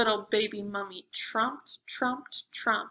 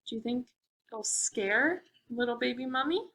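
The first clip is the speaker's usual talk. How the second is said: usual speaking voice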